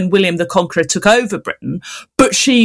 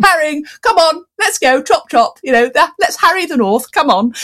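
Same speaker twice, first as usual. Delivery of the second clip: higher, sing-song posh lady calling her dogs; 7 semitones higher than usual. Retold or imitated. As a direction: call the dogs like a posh country lady out walking. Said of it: "like a posh lady going on a walk and calling her dogs".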